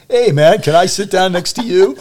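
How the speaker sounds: loud, high New Jersey guy; New Jersey accent. Retold or imitated. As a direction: greet him like a Jersey local. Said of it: "says with a perfect New Jersey accent".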